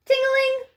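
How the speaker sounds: bell imitation. A narrator's reading.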